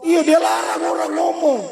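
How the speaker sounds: high laughing, crying-like whine; mock-weeping complaint. Real, not acted. Comic lament about shushing.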